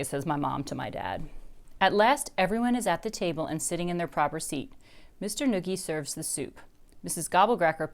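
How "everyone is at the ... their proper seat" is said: ordinary delivery, nothing marked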